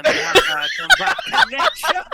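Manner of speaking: loud cracking laugh mid-line; breaking into laughter. Real, not acted. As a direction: lose it and laugh through the words.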